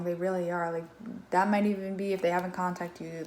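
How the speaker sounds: ordinary delivery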